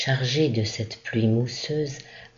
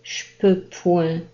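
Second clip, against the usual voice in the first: put-on old man's complaint; 7 semitones higher, 3 dB louder than usual. A narrator's reading. Old father feigning he can't move.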